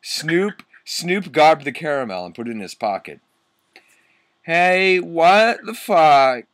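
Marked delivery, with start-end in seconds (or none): 4.4-6.4 high, loud, drawn-out protest; angry mock shout. Vampire objecting to the camera being taken, angry mock shout. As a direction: shout it slowly with hammed-up outrage. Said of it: "Vampire shooted angrily".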